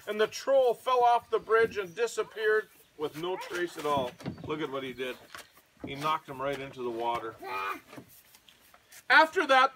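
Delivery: ordinary delivery, nothing marked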